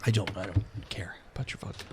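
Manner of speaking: fast, whispered brush-off; whispered aside. Real, not acted. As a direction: mutter it fast under breath. GM brushes off vodka.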